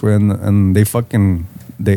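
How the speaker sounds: ordinary talk, nothing marked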